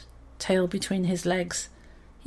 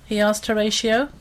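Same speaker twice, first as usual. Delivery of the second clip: ordinary delivery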